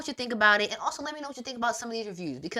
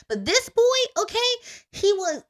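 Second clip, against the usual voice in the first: excited outburst; 11 semitones higher, 7 dB louder than usual. Real, not acted. Telling viewers about the male lead, loud and excited. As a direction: jump up loud and high as the gossip starts.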